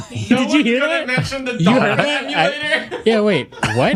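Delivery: high-pitched, cracking up while asking; laughing incredulously. Real, not acted. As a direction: ask it through helpless laughter. Cracking up at misheard remark.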